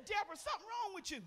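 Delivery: a hint of the teasing husband.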